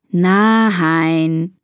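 drawn-out, groaned no; weary, put-upon moan; exasperated groan. A narrator's reading. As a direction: groan it like an annoyed sister. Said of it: "stöhnt Bea".

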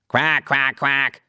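duck quacking. Acted. Willi keeps quacking.

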